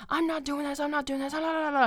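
high-pitched imitation of someone going off; mocking, high-voiced babbling tantrum; mock psychotic ranting. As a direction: rattle it off high, refusing to listen. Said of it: "psychotic and just going off".

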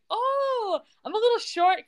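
high, drawn-out coaxing daughter; mock wheedling. Voices Brooke wheedling Daddy for money.

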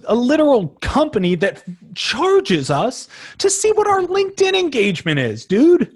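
high incredulous disbelief; can you believe this, dude. Real, not acted. Incredulous rant, pitch climbs.

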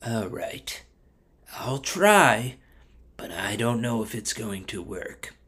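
soft, hesitant giving-in; reluctant agreement. Acted. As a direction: agree quietly, full of doubt. Bilam reluctantly gives in.

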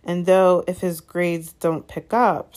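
loud, slow, cold disapproval; colder still. A narrator's reading. Father disparages his son, slowly and coldly. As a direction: weigh it out with mild iciness. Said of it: "more coldly still".

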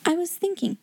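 a light, high reply from the pupil; lightly voiced Sarah. A narrator's reading. Pupil answering the angry headmistress, high and composed.

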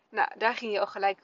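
ordinary delivery

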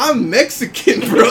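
high, drawn-out protest through laughter; indignant retort. Retold or imitated. Clerk corrects the angry customer, mock indignant protest.

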